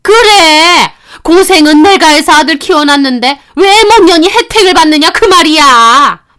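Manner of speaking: angry shout. Acted. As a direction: yell back her resentment. Mother-in-law shouting back. (버럭 소리치는 시어머니)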